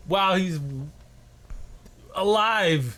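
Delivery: mock-horrified high pitch; incredulous outburst. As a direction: exclaim it, appalled and incredulous. Mock outrage at TV show scene.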